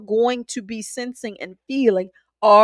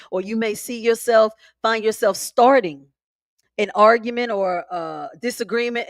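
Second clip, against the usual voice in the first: ordinary talk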